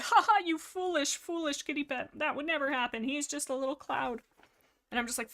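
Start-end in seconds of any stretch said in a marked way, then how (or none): 0.0-4.2 higher-pitched mocking ha-ha; mock scoffing. Fireheart ridicules his sister's hopes in her head, mock scoffing. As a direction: scoff at them in a put-on derisive voice. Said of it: "in my head he's like".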